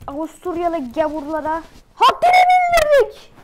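loud boast rising to a high scream; high, loud victory yell; triumphant shout. Real, not acted. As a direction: roar the victory, screaming the end. Triumphant cry after beating Austria.

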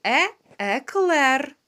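ordinary delivery

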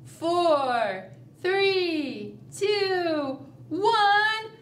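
rising countdown ending in a cheer; countdown to blast off. Shown as a demonstration. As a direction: count down with the class, then launch. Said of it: "let's count down".

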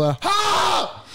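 high yelped war cry; mock kung fu shout while jumping on the table; battle yell. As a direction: leap up with a yell.

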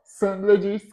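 ordinary delivery, nothing marked

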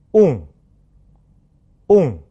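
on as a French nasal vowel, lips rounded and pushed out. Shown as a demonstration. Teacher models for repetition, on rounded and nasalised. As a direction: say on with air through mouth and nose, tongue back. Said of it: "这是一个鼻化元音".

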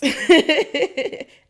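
loud high burst of laughter; real, high-pitched cackling laugh. Real, not acted. Breaks into laughter after agreeing.

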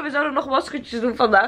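usual speaking voice

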